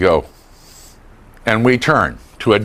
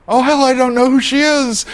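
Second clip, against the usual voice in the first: flustered; 13 semitones higher than usual. Shown as a demonstration. Acting brain's confused reaction.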